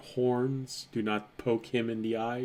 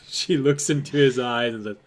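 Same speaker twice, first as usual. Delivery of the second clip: normal talking voice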